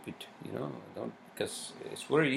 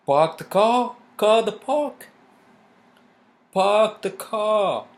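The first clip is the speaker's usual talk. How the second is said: high, loud Boston accent, dropping the r in car; 13 semitones higher, 9 dB louder, slower than usual. Shown as a demonstration. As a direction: shout it like a Bostonian, "Park the car". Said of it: "I can do the Boston accent".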